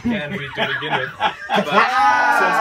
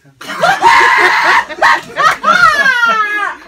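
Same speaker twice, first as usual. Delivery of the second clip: high shrieking laugh; loud burst of laughter; 15 dB louder than usual. Real, not acted. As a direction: howl with laughter. Host bursts out at the result.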